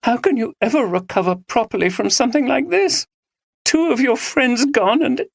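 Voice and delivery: high, near tears; on the verge of crying; tearful. A narrator's reading. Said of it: "She started to cry again".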